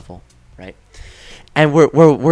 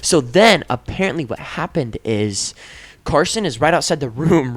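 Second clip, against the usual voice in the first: ordinary talk, nothing marked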